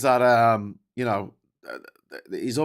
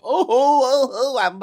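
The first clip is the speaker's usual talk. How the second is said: much higher, louder flustered oh's; 12 semitones higher, 9 dB louder than usual. Real, not acted. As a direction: caught out, scrambling. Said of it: "Oh, I haven't put a goal up".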